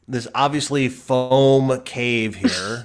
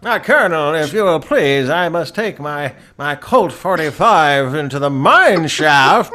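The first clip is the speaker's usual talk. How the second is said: Mark Twain; 2 semitones higher, 6 dB louder than usual. Acted. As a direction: play it hammy, punching every word. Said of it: "Mark Twain will come in there".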